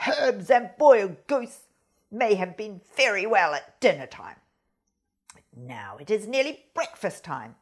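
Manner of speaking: loud, low furious cry of the fox; angry fox. A narrator's reading. Fox to goose over the boiled-away pot, loud and angry. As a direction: throw the words out in a temper.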